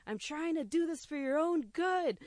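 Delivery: high, loud exasperated yell; mock frantic yell at two birds. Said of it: "heard me yelling at these two birds".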